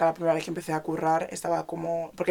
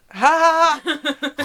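mock bitter laugh; 9 semitones higher, 6 dB louder, slower than usual. Friend with leukemia fake laughing.

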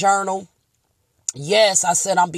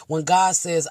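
ordinary talk

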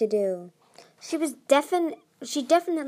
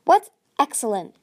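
demanding; 4 semitones higher, 9 dB louder than usual. A narrator's reading. Irritated demand.

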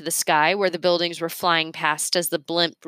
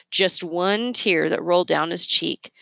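ordinary delivery, nothing marked

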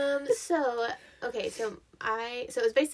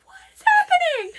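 much higher, louder panicked near-sob; panicked cry; 12 dB louder than usual. Retold or imitated. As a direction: cry out in panic as a scared kid. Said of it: "And so I'm freaking out".

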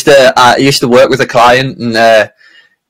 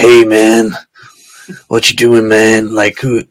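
cowboy voice; 2 semitones lower than usual. Retold or imitated. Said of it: "this kind of cowboy, uh, Wild West".

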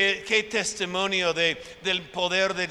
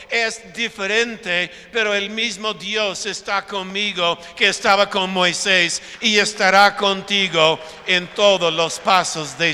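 rising into a loud preaching shout; preaching at full force; 7 dB louder than usual. Real, not acted. Pastor preaching to the congregation, loud and building. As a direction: proclaim it over the congregation, louder and louder.

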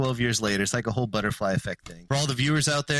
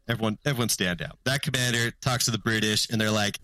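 ordinary delivery

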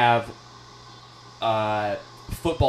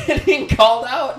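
laughing through words; 13 semitones higher, 8 dB louder than usual. Real, not acted. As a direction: giggle while teasing. Teasing absent friend, laughing.

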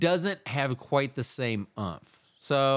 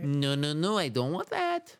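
put-on lightly Italian-accented protest; 3 semitones higher than usual. Host refusing an update, put-on Italian accent. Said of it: "accent do you think that was".

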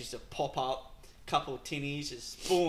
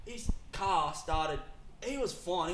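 normal talking voice

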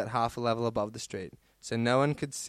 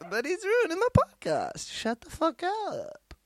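very high squealed complaint; mock outburst. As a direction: squeal it in mock outrage at the dog. Mock complaint about barking dog.

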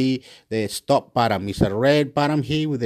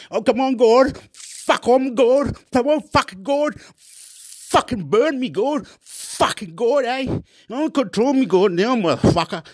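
high-pitched defiant goading; taunting God; 10 semitones higher than usual. Real, not acted. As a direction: dare them in a strained high voice. Taunting and daring God aloud.

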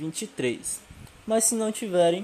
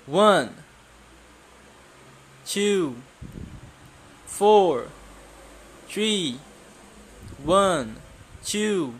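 teacher drill calling; 2 semitones higher, much slower than usual. Shown as a demonstration. Number drill again.

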